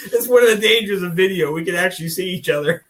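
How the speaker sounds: talking through real laughter; chuckling while explaining; laughing. Real, not acted. Host still laughing about being seen on video, laughing.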